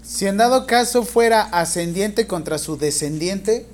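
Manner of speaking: loud, slow dictation in chunks. Real, not acted. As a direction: dictate slowly and clearly, projecting to the class. Teacher dictating exam question to students.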